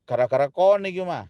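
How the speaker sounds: menyalahkan dengan logat Jawa Timur, nada tinggi; logat Surabaya marah. Retold or imitated. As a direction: bertengkar ala Surabaya. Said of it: "bahasa-bahasanya muncul semua". (marah-marah dengan logat Surabaya)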